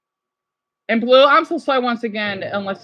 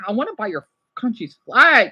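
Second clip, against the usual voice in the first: ordinary delivery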